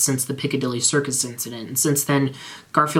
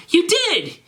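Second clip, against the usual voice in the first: screamed. Acted. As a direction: scream the blame. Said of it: "screamed Draco".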